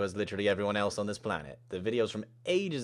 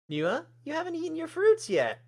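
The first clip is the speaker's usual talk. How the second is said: very high character voice; high-pitched caring friend; friendly fussing; 6 dB louder than usual. Acted. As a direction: sweetly chide a friend, pitched way up. Maddie fusses over the new student.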